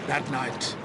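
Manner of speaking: plain reading voice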